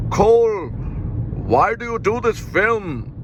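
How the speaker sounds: hammed-up German filmmaker impression; mock Werner Herzog. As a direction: pester Cole in a cartoonish German accent. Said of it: "if Werner Herzog found out about all this".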